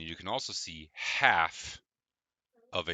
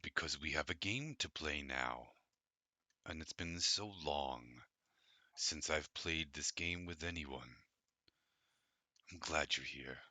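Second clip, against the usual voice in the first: low, soft, rumbling dragon; 3 semitones lower, 9 dB quieter than usual. Acted. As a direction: rumble it like a drowsy old dragon. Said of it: "The dragon rumbles, uh, deeply".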